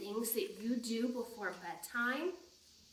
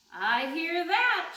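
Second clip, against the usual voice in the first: big booming shark, higher and louder; 5 semitones higher, 8 dB louder, slower than usual. Acted. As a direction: belt it out as a bold shark. Shark character answers at bedtime.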